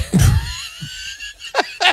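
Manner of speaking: a real laugh that breaks into a very high shriek. Real, not acted. Reacting to getting beaten.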